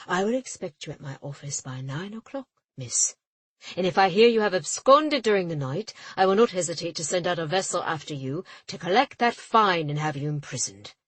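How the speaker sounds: an official threatening to jail the captain. A narrator's reading. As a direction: lay down the threat.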